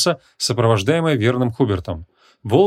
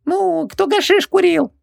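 exasperated genie; slower than usual. A narrator's reading. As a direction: squeak it out impatiently. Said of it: "Джин возмутился непонятливостью мага".

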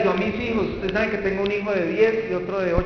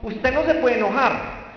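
scolding church elder; 6 semitones higher, 4 dB louder than usual. Retold or imitated. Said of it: "si alguna vez le dijeron".

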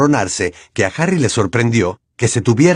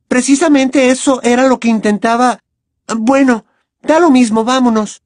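put-on higher, louder girl's voice, fed up; exasperated Hermione; 14 semitones higher, 5 dB louder than usual. A narrator's reading. Hermione exasperated with Harry, higher and louder. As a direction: throw up your hands and go. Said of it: "exclamó Hermione".

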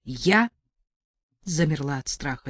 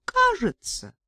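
coy admission; slower than usual. A narrator's reading.

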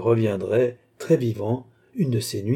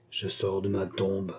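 slow, low, hushed ghostly voice; sepulchral voice; 3 semitones lower, 7 dB quieter than usual. A narrator's reading. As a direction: speak like a ghost from the grave. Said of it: "d'une voix sépulcrale".

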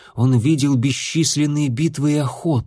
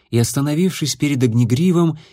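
ordinary delivery

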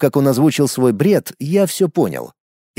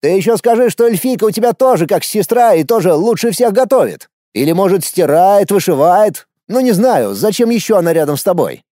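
higher, lively mocking tirade; exasperated, pitched-up sarcasm; indignant; 6 semitones higher, 3 dB louder than usual. A narrator's reading. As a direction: pile up the absurd suggestions. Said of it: "Резко переключился он на возмущение".

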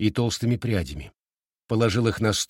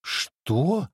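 high, startled question; surprised; 7 semitones higher than usual. A narrator's reading. Girl astonished by her friend's confession, surprised. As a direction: blurt it out in surprise. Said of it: "удивилась Фэн Хуан".

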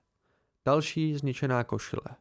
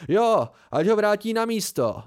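higher, louder jeer from the crowd; mocking call; 7 semitones higher, 6 dB louder than usual. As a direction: shout the jibe across the room. Bystander calls out mockingly.

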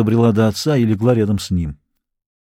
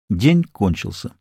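plain reading voice

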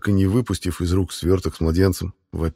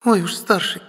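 put-on high girlish scoff; mocking; 12 semitones higher than usual. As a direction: sneer at the word older. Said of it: "насмешливо фыркает девушка".

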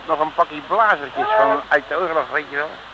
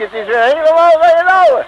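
cheerful crow; 12 semitones higher, 9 dB louder than usual. Acted. Happy back on boat.

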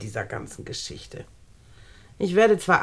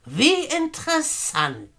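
higher, drawn-out sarcastic reply; cold sarcasm; 7 semitones higher, much slower than usual. Acted. Edna answers Julia's threat to kill her with icy sarcasm. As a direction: savour it with icy irony.

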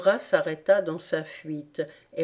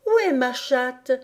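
mild, higher-pitched mischievous call; mischievous cry; 6 semitones higher than usual. A narrator's reading. Mischievous Alice calls out to startle the rat, mild playful cry. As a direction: call it out like a cheeky child, not shouting. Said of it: "cria l'espiègle enfant".

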